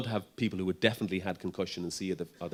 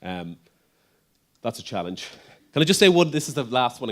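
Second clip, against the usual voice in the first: ordinary talk, nothing marked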